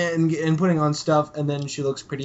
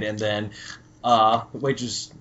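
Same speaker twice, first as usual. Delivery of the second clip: ordinary talk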